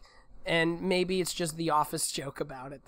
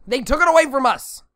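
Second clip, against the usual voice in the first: mock outrage; 8 semitones higher, 9 dB louder than usual. Mock lament.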